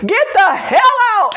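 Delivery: hammed-up angry shout that nobody fears; mock yell. Imitating Seinfeld's failed attempt at anger, a mock yell. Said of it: "he was, he was yelling".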